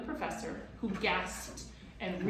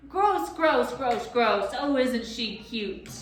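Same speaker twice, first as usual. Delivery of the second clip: high, loud mocking singsong; simpering sneer; 4 semitones higher, 9 dB louder, slower than usual. A narrator's reading. Gaboon mocking Lindy, simpering and sneering. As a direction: sneer it with false sweetness. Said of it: "simpered and sneered".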